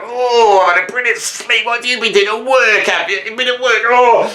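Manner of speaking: high, smug, lazy cat gloating. Retold or imitated. Cat gloating at its owner back from work, high and smug. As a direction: rub it in like a pampered cat just waking. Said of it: "They're like".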